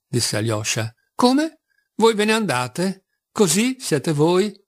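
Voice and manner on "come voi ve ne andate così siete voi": surprised outburst. A narrator's reading. Lise, startled that Alyosha is leaving, high surprised cry. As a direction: jump up in pitch, caught off guard.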